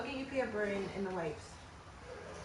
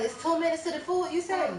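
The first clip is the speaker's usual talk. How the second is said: high, pushy questioning voice; mimicking the lady counting down to the food; mocking eager lady; 8 semitones higher than usual. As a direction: imitate her pestering about the food. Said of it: "she's like".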